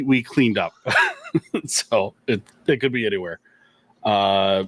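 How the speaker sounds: ordinary delivery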